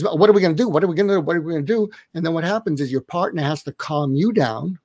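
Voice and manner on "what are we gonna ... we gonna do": a worried parent spiraling in panic; agitated panic. Retold or imitated. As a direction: parent frets to partner about the kids, mock agitated. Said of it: "our agitation comes out".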